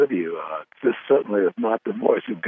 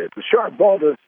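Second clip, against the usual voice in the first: higher, excited sportscaster call; play-by-play announcer; 11 semitones higher than usual. Retold or imitated. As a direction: call it like a kid doing radio play-by-play. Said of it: "I would say ... mimic play-by-play announcers".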